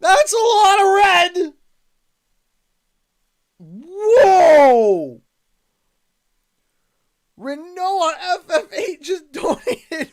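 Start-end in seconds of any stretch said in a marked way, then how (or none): 0.0-1.5 high, loud and slow with disbelief; real thrilled shout; excited shout. Real, not acted. Repeats the shout in amazement. 3.6-5.2 loud, long falling whoa; real stunned cry. Real, not acted. Still stunned by the donation.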